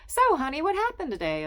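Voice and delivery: high, bright-voiced nosy wife; chatty Estelle. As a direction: gush at Sylvia like a meddling friend.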